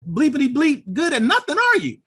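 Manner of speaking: high, loud scornful sneer with a laugh in it; mock drill instructor jeer. Instructor mocking the recruit at the range, loud high sneer. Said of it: "He's like".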